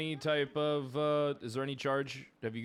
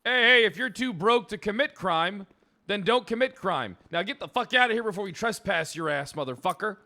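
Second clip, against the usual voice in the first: angry shouting; 6 semitones higher, 6 dB louder than usual. Real, not acted. Cop yelling at protester.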